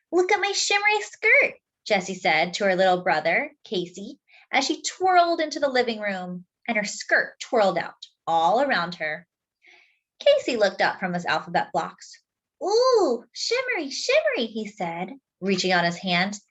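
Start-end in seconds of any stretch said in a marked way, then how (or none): 0.1-1.5 put-on high, bright little girl showing off her skirt; proud big sister, pitched way up; excited girl voice. Sister shows off her skirt to brother, high and proud. 12.6-14.5 put-on high, cooing toddler voice; little brother marveling, pitched very high; delighted little boy. Toddler brother admires sister's skirt, high and cooing.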